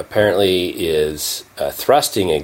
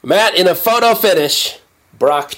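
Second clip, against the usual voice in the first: race caller; 12 semitones higher, 3 dB louder than usual. Acted. Mock race call after the clip.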